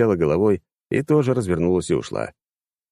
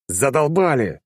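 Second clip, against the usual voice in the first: fed up, sharp and raised; harsh; 8 semitones higher, slower than usual. Acted. Leader losing patience with squabbling allies, harsh. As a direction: bark it out hard. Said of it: "жёстко произнёс я".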